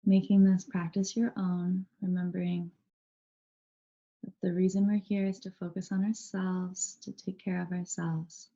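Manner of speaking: calm, even meditation guide with long pauses; unhurried, steady voice guiding a body scan; guided meditation. Real, not acted. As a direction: lead them gently into stillness, leaving space between phrases.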